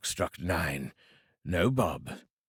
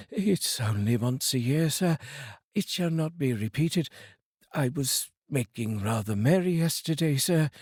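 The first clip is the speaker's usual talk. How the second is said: higher, hurried pleading; pleading clerk; 5 semitones higher, 3 dB louder than usual. Acted. Bob pleading with Scrooge for forgiveness, higher and anxious. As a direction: beg the boss for mercy.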